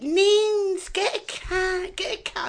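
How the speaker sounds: high, insistent nagging; nagging friend. Retold or imitated. Imitating her friend always urging her to get one, high nagging. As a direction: nag in a high, drawn-out voice. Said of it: "because he always said".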